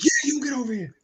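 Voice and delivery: high imitated summons; retold distant yell. Player confirming he means this teammate, imitated call. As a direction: call him over insistently, mimicking. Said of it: "yelling from a distance".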